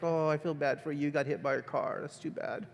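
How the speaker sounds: fading to a whisper of pity; soft pity, trailing off; mock sympathy. Shown as a demonstration. The teacher shows pity for a car victim, soft and fading. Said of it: "you're like".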